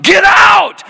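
a woman in labour screaming; screamed get out. Retold or imitated. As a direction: scream it at full force. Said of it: "she screams at the top of her lungs".

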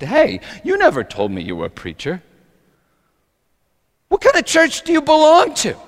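mildly hostile challenge leaping high at the end; a little hostility. Acted. Harry confronts Tony about being a preacher, a little hostile. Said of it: "with a little hostility".

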